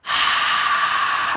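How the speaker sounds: breathy unvoiced rush of air; airy breath scream; whisper scream. Shown as a demonstration. Coach trying the whisper scream. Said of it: "let's introduce the whisper scream".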